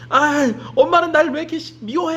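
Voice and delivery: put-on a sulky kid complaining as he runs; whiny child. Boy whining at his mom as he runs off, high whiny child voice. Child whines while running away.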